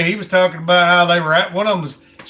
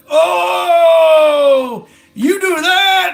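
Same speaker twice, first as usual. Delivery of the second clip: higher, louder sneering city braggart; mocking show-off; 10 semitones higher, 5 dB louder, much slower than usual. Mocking city folks' one-upmanship, high and loud sneer. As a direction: crow it like a strutting peacock.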